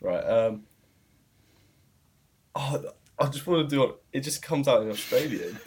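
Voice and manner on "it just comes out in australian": ordinary talk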